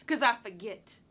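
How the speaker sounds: firm, voiced close after an outburst; firm conclusion. Woman closing the subject of her scars, firmly. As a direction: land it firmly, without softening.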